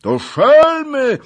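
high, very loud angry roar; thundering shout. A narrator's reading. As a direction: bellow them away. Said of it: "huknął Zagloba". (gromki, gniewny okrzyk)

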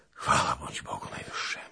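hushed, low thanksgiving; low, soft, tender relief; deeply moved. A narrator's reading. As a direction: murmur thanks to God, overcome. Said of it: "W głosie drgało mu głębokie rozrzewnienie".

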